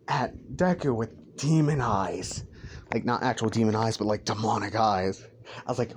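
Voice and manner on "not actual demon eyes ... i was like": ordinary delivery, nothing marked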